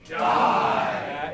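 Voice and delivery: loud shout. Shown as a demonstration. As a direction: shout the word. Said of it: "you're gonna shout".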